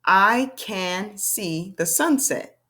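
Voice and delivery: very slow, each word spaced; slow model reading. Shown as a demonstration. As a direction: read it slowly for the class to follow. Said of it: "Let's read a sentence".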